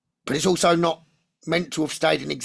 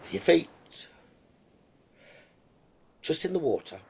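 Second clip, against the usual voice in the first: guided meditation; 2 semitones lower, 4 dB quieter than usual. Acted. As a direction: lull them gently toward the island.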